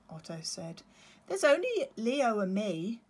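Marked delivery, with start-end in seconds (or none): 1.3-3.0 little chick. Acted. Otto voiced.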